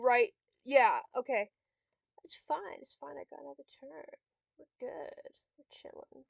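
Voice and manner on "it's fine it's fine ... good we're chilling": very soft, near-whispered calm; calm yourself under your breath; tiny voice, barely audible; hushed self-reassurance. Real, not acted. Calming self after cursing.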